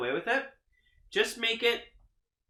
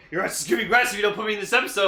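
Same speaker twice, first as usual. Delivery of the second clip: fast, louder, higher mock tirade; mock threat; 4 semitones higher, 6 dB louder, much faster than usual. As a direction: play an indignant outburst, rushing and getting louder. Acted threat in name bit.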